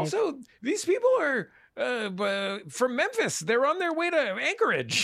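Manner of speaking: very high, exasperated protest; mock incredulity. Host protests about airport travellers, mock incredulity. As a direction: argue the absurdity in a high pitch.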